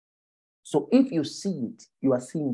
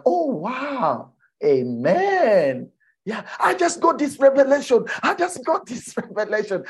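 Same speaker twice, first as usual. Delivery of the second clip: high-pitched, hammed-up enthusiasm; put-on excitement; 11 semitones higher, 4 dB louder than usual. Young believer sharing a new revelation, gushingly excited. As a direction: gush as if thrilled by a discovery. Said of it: "You go with the excitement".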